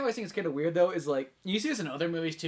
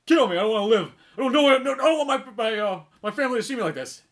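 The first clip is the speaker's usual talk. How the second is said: loud, higher-pitched begging; mock desperate pleading; 3 semitones higher, 6 dB louder than usual. Retelling RoboCop seeing his stripped body, mock desperate plea. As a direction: plead in hammed-up anguish. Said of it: "And he's like".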